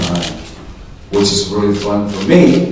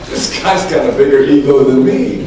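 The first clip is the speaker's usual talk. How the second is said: ordinary delivery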